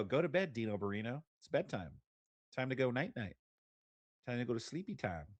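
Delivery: put-on hushed talk to a small child; soft bedtime coaxing. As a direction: gently send a little one to sleep. Soothing a small child to bed.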